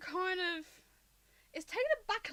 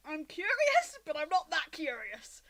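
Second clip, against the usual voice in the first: pitched-up, loud burst on curious, then easing; torn, warring; 5 semitones higher, 8 dB louder than usual. Acted. Game master voices a character's warring moment, torn. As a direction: torn between curiosity and backing off, voice jumping up. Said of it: "there's a warring moment there".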